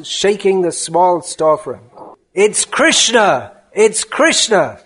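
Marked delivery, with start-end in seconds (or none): 2.3-4.8 slow, emphatic cry pitched up; heated, insistent exclamation; indignant roar. Acted. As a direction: hammer out each repeat in outrage. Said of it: "he roars".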